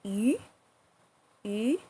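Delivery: "Yu" with an upward sweep; rising second tone. Shown as a demonstration. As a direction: say "Yu, yu" like a short question. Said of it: "A good example as when we say fish".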